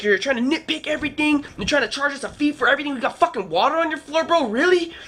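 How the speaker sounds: high-pitched indignant rant; imitated incredulous, exasperated tirade; retold going off. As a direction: replay yourself chewing someone out. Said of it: "started going off on him".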